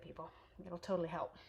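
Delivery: normal talking voice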